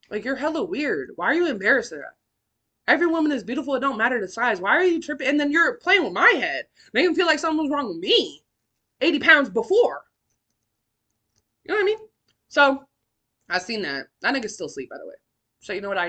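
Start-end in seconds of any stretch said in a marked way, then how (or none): 2.9-12.8 heated outburst. Real, not acted. Venting about ex's insults.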